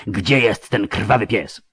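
slow, threatening interrogation; menacing leader. A narrator's reading. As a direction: lean in and demand an answer. (herszt groźnie przesłuchuje starca, powoli)

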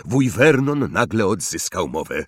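usual speaking voice

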